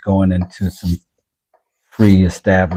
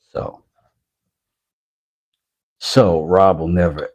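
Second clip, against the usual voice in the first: normal talking voice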